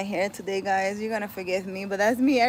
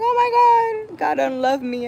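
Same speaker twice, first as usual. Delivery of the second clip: shrill high mock wail of despair, much louder; mock panic; 11 semitones higher, 7 dB louder than usual. Mocking her followers who despair of God, hammed-up panicky wail. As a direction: ham up a panicking believer crying out. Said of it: "You can't go crazy and be like".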